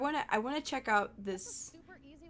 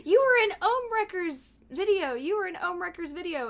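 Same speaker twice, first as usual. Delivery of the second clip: higher, louder, excited chatter; excited fan quote; 8 semitones higher, 4 dB louder than usual. Retold or imitated. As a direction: imitate eager fans repeating it. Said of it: "people coming by my stream saying".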